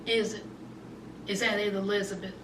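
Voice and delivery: hushed sarcasm. Real, not acted. Host asking Elizabeth sarcastically, hushed and pointed. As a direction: quietly needle her.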